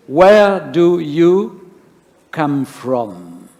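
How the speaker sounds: drawn-out slow God question, fading at the end; slow, searching divine question; slow, weighty question. Shown as a demonstration. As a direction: stretch each word as God asking. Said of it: "That's a strange question".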